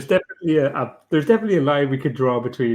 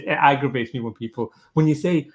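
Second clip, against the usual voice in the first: ordinary delivery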